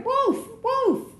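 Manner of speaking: repeated high shouts; crying out. A narrator's reading. Boy running to town, high repeated cries. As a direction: call it again and again while running.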